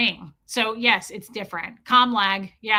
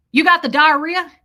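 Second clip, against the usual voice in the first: mock Southern accent, blunt question; Southern man; 6 semitones higher, 10 dB louder than usual. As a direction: blurt it as a nosy Southern man. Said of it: "Southern man goes".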